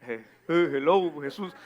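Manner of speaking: confused, low 'hello, Jesús' with a laugh; a hint of a baffled beggar; mock blind man. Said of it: "te imaginas al ciego así como". (mendigo confundido, con risa y voz grave)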